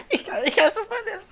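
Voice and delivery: high-pitched laughing protest. Real, not acted. Reader laughing, unable to read the word aloud, laughing. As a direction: crack up while saying you can't read it. Can't finish reading, laughing.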